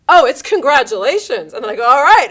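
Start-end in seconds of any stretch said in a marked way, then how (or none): 0.1-1.5 mock cheerful. People answering the divorce question. 1.8-2.3 a loud, high, celebratory all right; cheery all right. Retold or imitated. Greeting divorce news with a loud high-five cheer.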